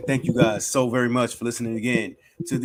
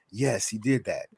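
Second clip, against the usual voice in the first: ordinary talk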